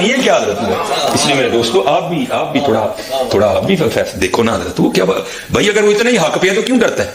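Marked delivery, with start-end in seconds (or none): none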